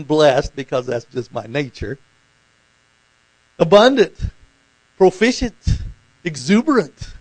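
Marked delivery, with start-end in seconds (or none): 3.6-7.1 loud, raised, separate one-word answers; emphatic substitute answers. Acted. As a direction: throw out each word loudly as an answer. Acting out substitute words for blessed.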